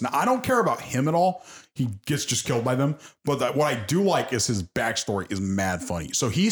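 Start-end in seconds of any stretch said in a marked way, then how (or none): none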